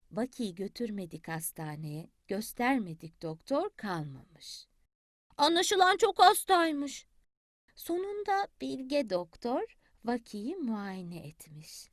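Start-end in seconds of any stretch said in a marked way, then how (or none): 0.1-4.7 quiet, slowing bedtime narration; soft storytelling. A narrator's reading. Grandmother tells the sick duckling's tale softly. 7.7-11.9 quiet, slow bedtime telling; soft slow narration. A narrator's reading. Grandmother tells the sick duckling's tale softly, slowly.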